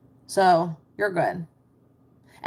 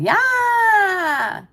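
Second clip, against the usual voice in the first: excited yeah; 13 semitones higher, 6 dB louder than usual. Real, not acted. Agreeing with a chat comment.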